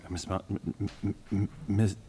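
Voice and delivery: stammering on the m sound; stuttering. Real, not acted. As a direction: repeats m before the name. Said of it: "I'm stuttering again".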